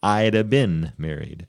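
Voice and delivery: slurred "I'd have been", run into "I'da bin"; casual contracted form. Shown as a demonstration. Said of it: "people talk like that all the time".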